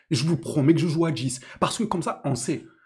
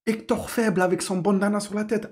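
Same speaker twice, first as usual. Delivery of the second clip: ordinary delivery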